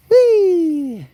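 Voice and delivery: long high-pitched whee; high loud whee. Pretend. Streamer cheering as the bug is let go, long high whee. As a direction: whoop a loud, drawn-out whee. Playful whoop.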